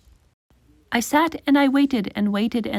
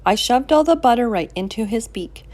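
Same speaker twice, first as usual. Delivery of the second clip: ordinary delivery, nothing marked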